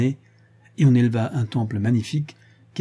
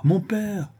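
second "mon père!" cried out much higher; anxious cry; 9 semitones higher than usual. A narrator's reading. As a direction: call "mon père!" pitched high in alarm. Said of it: "s'écria le jeune homme".

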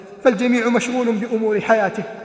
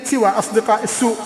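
rising to a loud shout on the oaths; preacher warning young Muslims, full force; fiery preaching; 5 semitones higher, 6 dB louder than usual. Acted. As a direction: thunder the warning at the youth.

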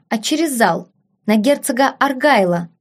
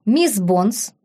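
usual speaking voice

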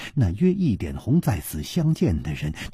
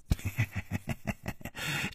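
soft, drawn-out staccato chuckle; hushed, creepy heh-heh laugh; villain's snicker; 13 dB quieter than usual. A narrator's reading. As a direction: snicker quietly at your own joke. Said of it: "他自己已经大笑".

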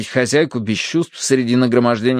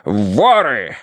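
drawn-out angry exclamation; furious colonel. A narrator's reading. Colonel throws down his napkin over the thieves, furious. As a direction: spit out the accusation. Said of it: "в ярости швырнул салфетку".

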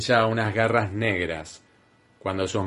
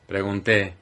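usual speaking voice

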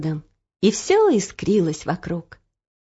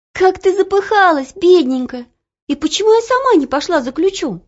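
put-on young girl voice; 10 semitones higher, 3 dB louder than usual. Olya fussing over grandma.

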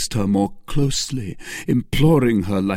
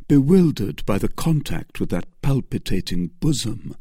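ordinary delivery

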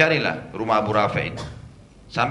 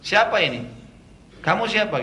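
high, loud alarmed demand; frightened man woken in the dark; startled challenge; 7 semitones higher, 4 dB louder than usual. Retold or imitated. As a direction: snap out who's there, startled. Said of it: "Maka Abu Rafi' bilang".

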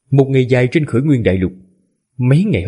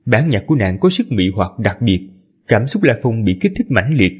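usual speaking voice

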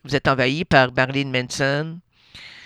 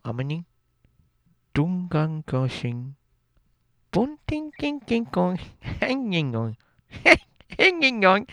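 speaking in tongues; slower than usual. Pretend. Speaking in tongues, mock trance.

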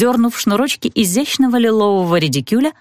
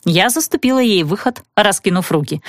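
ordinary delivery, nothing marked

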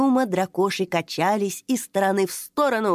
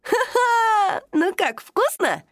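high, happy, pleased laughing voice; delighted laugh; 11 semitones higher, slower than usual. Acted. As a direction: cheer at the crunching. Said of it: "обрадовался Тоша".

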